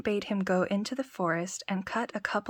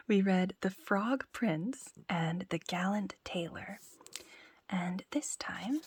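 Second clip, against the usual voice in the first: soft whispered storytelling; bedtime story whisper; 4 dB quieter than usual. Acted.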